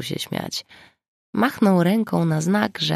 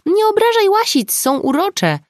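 much higher, louder character voice; playful interjection; 6 dB louder than usual. A narrator's reading. Zuza cutting in to defend weasels, high and loud. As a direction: pipe up in a high-pitched voice.

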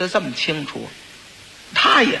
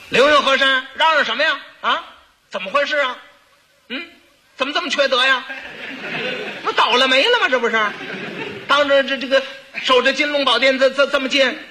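high, fussy nagging, not princely at all; unroyal fussy scolding; 7 semitones higher, faster than usual. Acted. The prince's rebuke botched on purpose, high and fussy. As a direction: scold like a petty busybody instead of a prince. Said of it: "那不是王爷了".

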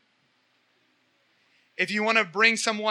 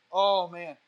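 ordinary talk

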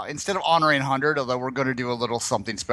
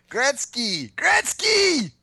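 high-pitched whooping cheer, rising higher; mock excited cry; 11 semitones higher, much slower than usual. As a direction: cry the name out in playful hype. Mock chanting cry of the name.

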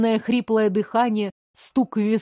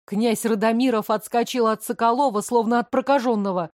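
plain reading voice

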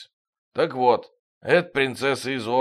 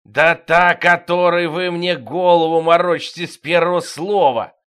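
loud, higher angry growl; 4 semitones higher, 6 dB louder than usual. A narrator's reading. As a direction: snarl it in fury. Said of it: "прорычал Пистон девятый".

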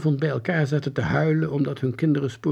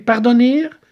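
high, loud archaic query; ceremonious puzzled question; baffled soldier; 12 semitones higher, 12 dB louder than usual. A narrator's reading. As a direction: beg pardon in stiff bewilderment. Soldier fails to understand the word.

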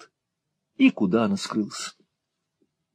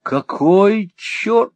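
much higher and slow; startled exclamation; 12 semitones higher, 4 dB louder, much slower than usual. A narrator's reading. Young lord hearing a surprise, much higher cry. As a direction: cry out in disbelief. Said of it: "вскричал молодой лорд".

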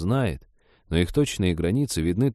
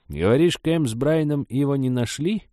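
put-on intrigued old woman, higher-pitched; curious, leading question in a raised voice; 7 semitones higher than usual. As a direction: probe with keen curiosity. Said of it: "заинтригованно протянула Степановна".

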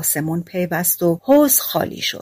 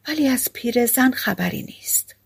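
usual speaking voice

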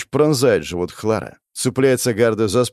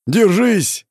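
much higher, loud call to a falling ally; shouted; 10 semitones higher, 4 dB louder than usual. A narrator's reading. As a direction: hold on, yelled across the fight. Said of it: "кричит Джозеф".

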